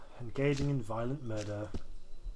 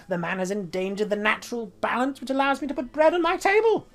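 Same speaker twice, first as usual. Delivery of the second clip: indignant rant; 7 semitones higher, 6 dB louder than usual. Acted. Wizard NPC ranting about engine.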